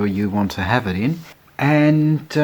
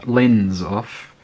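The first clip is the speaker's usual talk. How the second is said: ordinary delivery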